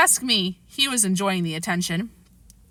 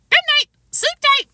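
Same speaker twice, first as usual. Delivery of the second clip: very high, loud squawky parrot voice; parrot reply; 6 dB louder than usual. A narrator's reading. Boy faking the parrot's reply, very high squawk. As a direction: answer as the parrot would, squawking. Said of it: "came the reply".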